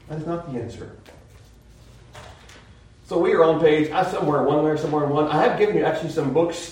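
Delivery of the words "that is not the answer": dropped much softer, closing the point; preacher repeating his point to the class, now softly; quiet repeat. Real, not acted. Softer echo of the line.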